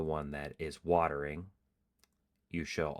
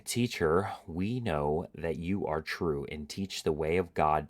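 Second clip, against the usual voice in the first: steady, earnest reading of a Bible passage; scripture reading; 5 dB louder than usual. Acted. Host reading the parable aloud, earnest and steady. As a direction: read the parable to the viewers.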